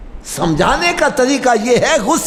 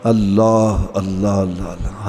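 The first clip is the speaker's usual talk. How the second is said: awed exclamation; 12 semitones lower, slower than usual. Real, not acted. Preacher marvels at child's answer.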